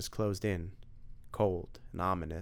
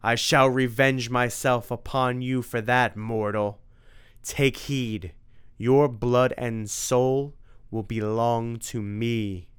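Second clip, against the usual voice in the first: louder, slow threat; vengeful vampire; 2 semitones higher, 6 dB louder, slower than usual. Acted. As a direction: swear revenge on him. Vampire vowing revenge.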